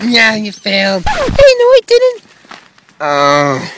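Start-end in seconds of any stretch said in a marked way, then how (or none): 1.1-2.2 high protesting voice. Acted. Protest in character voice.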